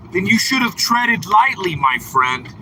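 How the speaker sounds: normal talking voice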